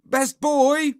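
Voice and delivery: high, loud summons; shout. A narrator's reading. Henry summons his best boy, shouting. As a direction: shout for your underling. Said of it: "shouted Horrid Henry".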